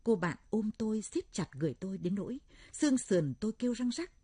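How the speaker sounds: usual speaking voice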